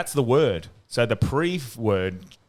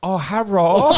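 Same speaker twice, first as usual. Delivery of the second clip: high, loud caricature of Japanese accent; badly faked Japanese accent; mock Japanese accent; 8 semitones higher, 6 dB louder, slower than usual. Shown as a demonstration. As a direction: put on a bad Japanese accent, pitched up. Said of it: "Do an example".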